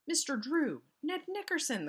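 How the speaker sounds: high, loud relieved calls; cried in relief. A narrator's reading. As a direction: call out their names with relief. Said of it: "the cousins cried in relief".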